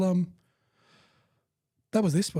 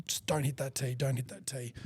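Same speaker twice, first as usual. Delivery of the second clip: quiet self-talk; 7 dB quieter than usual. Retold or imitated. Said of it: "And I'm".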